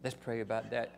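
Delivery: soft, tender honey voice; sweet wife imitation. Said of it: "she just became so sweet".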